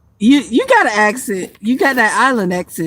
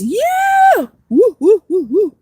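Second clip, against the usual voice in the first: high drawn-out yeah, then whooping; celebratory cheer; 10 semitones higher, much slower than usual. Real, not acted. Streamer thanking viewers for likes, celebratory cheer. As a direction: whoop it up over a small win.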